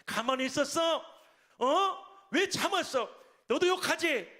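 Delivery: high-pitched, mock-angry questions; retold indignant scolding. Senior pastor scolding him for holding back, indignant retold outburst. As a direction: scold on someone else's behalf, voice jumping up. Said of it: "저를 대신해서 욕을 해주시더라고요".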